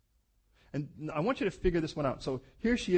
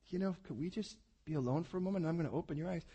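gentle God voice; 6 dB quieter, faster than usual. Retold or imitated.

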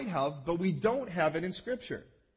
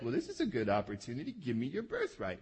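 scheming Jacob; 6 semitones lower, 5 dB quieter than usual. Retold or imitated. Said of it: "Jacob's like".

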